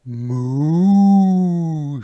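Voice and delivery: long, loud, rising-then-falling moo; cow moo. A narrator's reading. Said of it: "said the cow".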